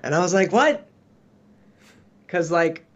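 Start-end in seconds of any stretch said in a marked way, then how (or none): 0.5-0.9 high, startled "what?"; surprised what. Retold or imitated. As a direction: blurt it out in disbelief. Retold own baffled reply.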